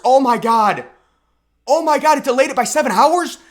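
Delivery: over-the-top fake astonishment; mock shock. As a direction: act scandalized over a trivial delay. Mock shock at January sixth.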